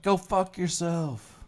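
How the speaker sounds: high, slow sneering put-down; mock New Yorker. Streamer voices a passerby telling the tourist off, high and slow. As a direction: drawl out a rude brush-off. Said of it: "somebody's like".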